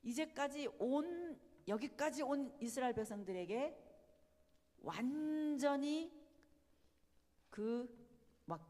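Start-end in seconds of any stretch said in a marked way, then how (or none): none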